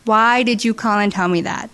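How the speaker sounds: usual speaking voice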